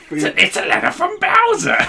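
very high, laughing cartoon voice; excited character voice. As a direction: squeak it out through laughter. Imitating game character line.

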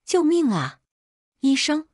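high-pitched urgent cry for help; frantic shout. A narrator's reading. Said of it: "我妈急得喊".

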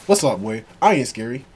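ordinary talk, nothing marked

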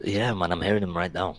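soft, low, hushed voice; whispering. Real, not acted. Answering the group about parents next door, hushed. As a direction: speak low so as not to be overheard. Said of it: "I'm gonna be whispering right now".

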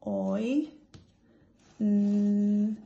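each sound said apart, slow and separate; segmented phonics sounds; sounding out letters. Shown as a demonstration. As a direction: break the word into its sounds. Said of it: "So let's sound it out".

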